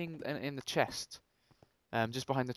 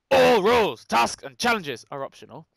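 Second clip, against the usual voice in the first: loud, higher-pitched opening blast; emphatic announcement; 4 semitones higher, 9 dB louder than usual. Pretend. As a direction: announce the rules loudly, then ease off. Reads map rules out emphatically.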